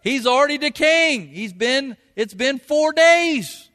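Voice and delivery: high, pleading Martha voice, slowed. Retold or imitated. Sister warning Jesus at the tomb, high and pleading. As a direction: voice her worry about the smell. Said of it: "Martha, the dead man's sister, told him".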